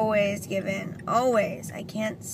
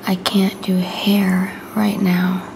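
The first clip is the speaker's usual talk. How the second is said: whispering; 7 dB louder than usual. Real, not acted. Whispers to camera throughout.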